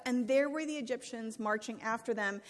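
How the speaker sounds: usual speaking voice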